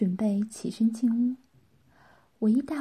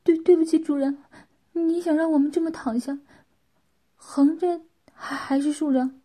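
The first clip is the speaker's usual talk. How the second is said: higher, stammered quick reply; quick answer; 5 semitones higher, 4 dB louder than usual. A narrator's reading. Servant asking the master how to lie down, quick anxious reply. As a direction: answer promptly, tripping over the apology. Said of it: "风很快地回答道".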